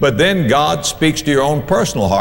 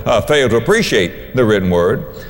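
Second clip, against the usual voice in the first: normal talking voice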